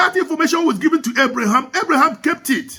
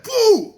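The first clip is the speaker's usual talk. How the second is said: high-pitched mock fart "poo"; sudden high "poo" for breaking wind; imitated fart noise; 11 semitones higher than usual. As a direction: blurt a comic, high fart sound. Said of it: "and just trumped".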